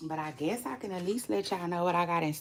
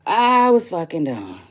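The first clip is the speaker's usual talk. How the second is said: louder, full voice; loud declaration; 9 dB louder than usual. Shown as a demonstration. Telling viewers loudly she was done.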